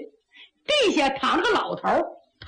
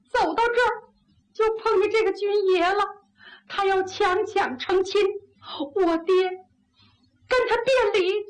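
put-on high, plaintive girl's voice; grieving young woman; 7 semitones higher than usual. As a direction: plead to the general for your murdered father. Bereaved girl tells her story. (姑娘悲切哭诉的声音)

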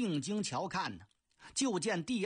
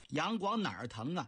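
ordinary delivery

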